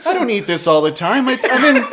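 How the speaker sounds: loud, high defensive rant; exasperated. Retold or imitated. Host defending his studio eating habits, loud exasperated protest. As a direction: argue back, mock-indignant and amused. Continues acted argument about food.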